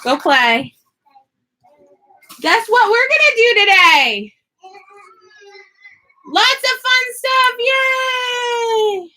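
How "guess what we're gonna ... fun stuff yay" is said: high and loud, a cheery "yay" shout; excited intro. Acted. Vlogger greeting viewers with an excited shout. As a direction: hype the viewers up for the day.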